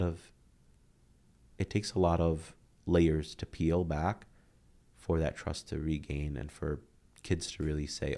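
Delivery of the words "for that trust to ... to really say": ordinary talk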